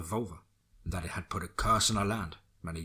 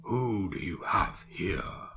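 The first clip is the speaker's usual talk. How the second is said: very slow, menacing stranger; ominous voice; much slower than usual. Acted. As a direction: draw it out, dark and threatening. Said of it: "an ominous voice said".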